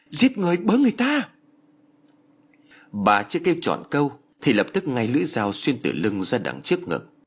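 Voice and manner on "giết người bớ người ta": very high-pitched cry of murder; panicked cry. A narrator's reading. Woman chased by knife-wielding daughter-in-law, panicked cry. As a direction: raise the alarm in panic, voice way up. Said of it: "Bà hoảng hốt kêu lên".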